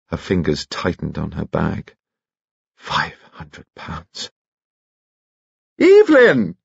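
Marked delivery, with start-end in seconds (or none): 2.8-4.3 hushed dread. A narrator's reading. Anxious private thought about the money. 5.8-6.6 high, loud call; crying out. Acted. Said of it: "cried Lady Allen".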